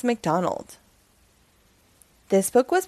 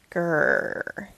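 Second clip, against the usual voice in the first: low grumbling growl; 5 semitones lower, 4 dB quieter than usual. Acted. Wilford growls, low grumbling growl. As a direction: growl in annoyance.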